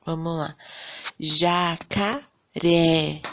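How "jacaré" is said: Jacaré in slow, drawn-out syllables, RÉ hit hardest; stress on last syllable. Shown as a demonstration. Teacher showing pupils the tonic syllable, stress on RÉ in Jacaré. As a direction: say Jacaré syllable by syllable, punching the final RÉ. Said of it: "a sílaba que a gente fala com mais entonação".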